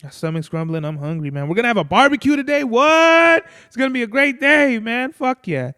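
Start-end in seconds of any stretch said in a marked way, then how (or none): 1.5-5.7 loud, high and fast with glee; shouting with real excitement; hyped up. Real, not acted. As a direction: burst out excited about the barbecue. Hungry, hyped about barbecue.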